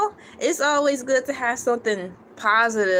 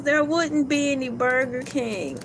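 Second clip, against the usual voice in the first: normal talking voice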